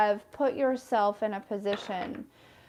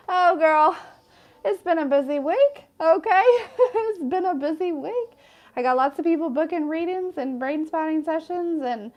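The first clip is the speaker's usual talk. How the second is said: bubbly, higher and louder, laughing through it; excited chatter; 6 semitones higher, 5 dB louder than usual. Real, not acted. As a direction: gush to the viewers about the busy week. Excited chatty aside to viewers.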